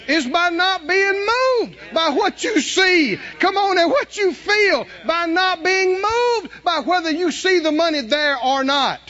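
high, loud preaching shout; full-force preaching. Real, not acted. Preacher rousing the congregation about faith, high loud shout. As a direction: drive it home to the congregation.

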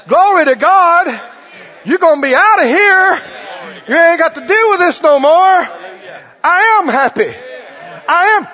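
loud, high rejoicing; joyful outburst. Retold or imitated. Believer rejoicing aloud for Jesus going to the Father, loud and high. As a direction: let the praise break out with joy. Voiced believer bursts into joy.

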